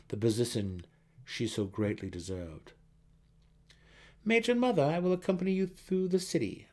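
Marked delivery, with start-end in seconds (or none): 4.2-6.7 high, louder eager plea; eager son offering. A narrator's reading. Son Dinan eagerly offers to escort his mother. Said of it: "offered Dinan".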